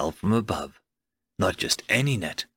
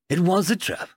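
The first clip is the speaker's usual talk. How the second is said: coolly admitting it, pitched up; acknowledging; 6 semitones higher than usual. A narrator's reading. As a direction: concede the point, stressing "was". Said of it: "Ben acknowledged".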